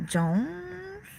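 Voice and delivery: high, rising, soft disbelief; a real double take at the name; spotting a viewer's name in chat, startled; surprised. Real, not acted.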